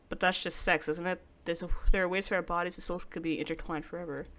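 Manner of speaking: ordinary delivery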